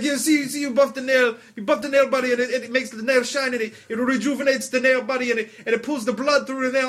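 high, eager mock-Israeli accent; pushy Israeli hawker impression; Israeli salesman pitch. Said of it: "he starts going into his whole thing".

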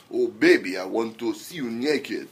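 low, soft mock-Russian seduction; bad Russian accent. Shown as a demonstration. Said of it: "a Russian accent".

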